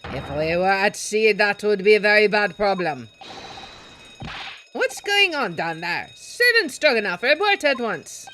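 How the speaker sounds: gruff sailor yelling. Acted. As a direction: bellow at the intruders like an angry deckhand. Ship sailor voiced in game.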